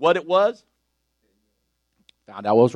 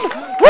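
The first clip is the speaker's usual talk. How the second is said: high, much louder whoop; joyful woo; 9 dB louder than usual. Real, not acted. Preacher celebrating his family in church, joyful whoop.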